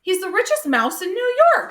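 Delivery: gleeful teasing reveal. Acted. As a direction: show off the mouse's secret. Harry teasing Tucker.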